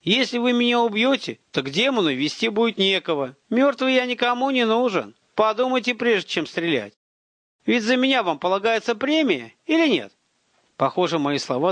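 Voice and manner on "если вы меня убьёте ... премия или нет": high, carrying, insistent appeal; shouted reasoning. A narrator's reading. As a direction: project the bargaining across a distance. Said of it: "чтобы оба хорошо слышали меня".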